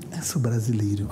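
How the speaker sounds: whispered, low confession; hushed admission. Retold or imitated. Brazilian in the US telling locals his origin, hushed.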